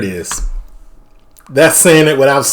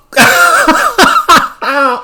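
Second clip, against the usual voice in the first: high burst of laughter; laughing. Real, not acted. Viewer amused by own British mimicry, high laughing. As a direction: crack up laughing.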